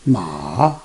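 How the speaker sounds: long "Ma" dipping very low, then rising; third tone. Shown as a demonstration. Teacher reading the four tones, third "Ma" down then up. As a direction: sink "Ma" deep and lift it. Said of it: "read those four tones all together".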